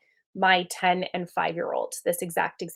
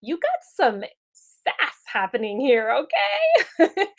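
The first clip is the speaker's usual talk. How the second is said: mock sassy call-out; 6 semitones higher, 3 dB louder than usual. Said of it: "we're listening to her tone of voice and we're like".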